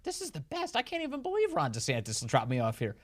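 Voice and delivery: high, gushing delight; hammed-up ecstatic newcomer; mock gushing migrant. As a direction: rave like you found paradise. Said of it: "They're like".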